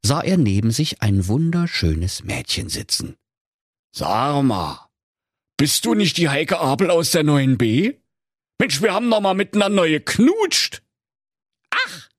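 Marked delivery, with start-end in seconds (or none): 3.9-10.8 high-pitched, eager recognition; surprised old grouch. Acted. Old miser recognises his school sweetheart, surprised. 11.7-12.1 very high, short "ach"; indignant girl. Acted. Former sweetheart answers the miser, indignant.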